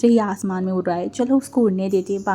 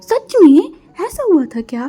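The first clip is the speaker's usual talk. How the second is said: high, loud shocked question; 7 semitones higher, 9 dB louder than usual. Acted. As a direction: gasp it out in disbelief. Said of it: "वही shocked होने बोला". (चौंककर, ऊँची और तेज़ आवाज़ में)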